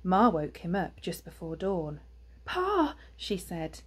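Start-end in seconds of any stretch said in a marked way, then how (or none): 2.4-2.9 high-pitched worried wife; high, urgent call to her husband; worried mother hedgehog. A narrator's reading. Ma wakes Pa, worried.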